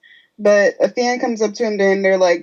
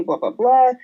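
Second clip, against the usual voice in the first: ordinary delivery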